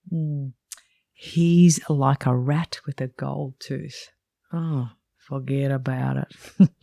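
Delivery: slow, put-on Italian accent; strong Italian accent. Narrator quoting her old boss about the rep, strong Italian accent. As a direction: play an Italian boss, drawing the words out. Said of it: "he said in a strong Italian accent".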